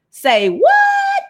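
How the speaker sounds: high, squealed disbelief; comic, high-pitched double take; incredulous. Acted. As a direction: shoot up high in mock astonishment. Comic reaction to tax exemption.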